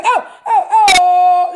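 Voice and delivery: half-laughing, half-crying cries of oh; overwhelmed oh. Shown as a demonstration. Demonstrating her reaction to the scripture, laughing, near-tearful oh cries. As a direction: show how the scripture overwhelmed you. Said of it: "like this, oh".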